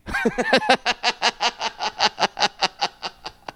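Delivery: high cackling laugh to end the call; long mocking laugh. Real, not acted. Laughing after hanging up.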